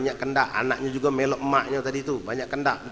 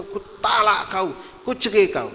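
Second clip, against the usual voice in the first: higher, heated pronouncement, drawn out; mock angry declaration; 7 semitones higher than usual. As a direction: act out a fed-up husband's outburst. Retold angry divorce declaration.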